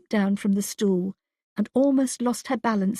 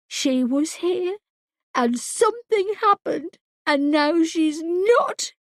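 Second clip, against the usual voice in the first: higher and slower, voice cracking; breaking into tears; 7 semitones higher, slower than usual. A narrator's reading. Wife confronts husband about missing daughter, tearful. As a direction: let it crack into crying.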